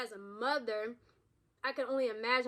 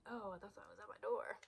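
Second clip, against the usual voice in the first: hushed startled whisper toward a noise; whispered aside; 2 semitones lower, 13 dB quieter than usual. Real, not acted. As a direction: drop to a breathy whisper, checking the door.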